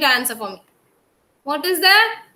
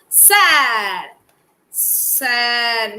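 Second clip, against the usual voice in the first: slow sad drawl; 3 semitones lower than usual. Shown as a demonstration. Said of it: "teacher drawls the emotion word".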